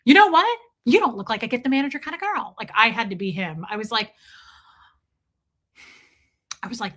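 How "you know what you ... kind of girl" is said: high, loud, fast server; waiter's voice. Retold or imitated. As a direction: play the chirpy restaurant guy placating you. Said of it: "the guy at First Watch".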